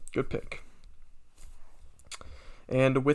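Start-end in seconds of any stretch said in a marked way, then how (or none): 0.1-0.6 soft, half-voiced good pick to himself; a private little pat on the back; muttered self-praise. Real, not acted. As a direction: quietly congratulate yourself. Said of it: "Did you just tell yourself, "Good pick".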